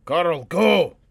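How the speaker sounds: loud high yell; urgent shout. A narrator's reading. Dwarf urging Carl to escape, shouting. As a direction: scream at him to run.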